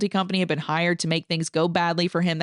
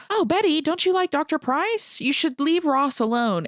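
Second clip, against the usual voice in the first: hammed-up sly persuader, pitched way up; wheedling Bosch; 10 semitones higher than usual. Bosch pressing Betty to sleep with the doctor, high mock coaxing.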